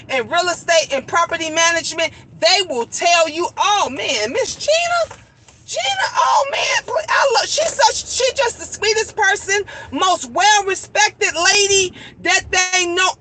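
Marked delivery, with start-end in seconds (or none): 3.6-13.1 imitated gushing client. Acts out clients gushing about her.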